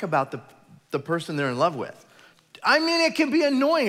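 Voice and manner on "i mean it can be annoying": high, loud exasperation; exasperated. Real, not acted. Joking about engaged couples, loud and exasperated. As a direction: throw up your hands.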